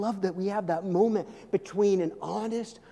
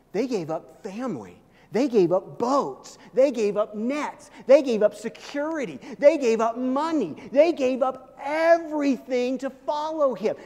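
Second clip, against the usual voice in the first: each line louder and higher, slowing; building list; 5 semitones higher, 4 dB louder than usual. Real, not acted. Preacher listing disciples' sacrifices, building to a peak. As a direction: pound each they gave up, climbing.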